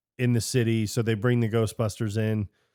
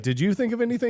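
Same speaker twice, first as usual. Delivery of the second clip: high-pitched frantic question; mock frantic question; 11 semitones higher, faster than usual. Quizzing Egon in a retold scene, high frantic mock question. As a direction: squeak out the question in a panic.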